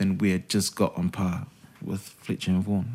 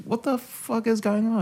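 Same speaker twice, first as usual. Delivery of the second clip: baffled. Retold or imitated. Confused by the angry call.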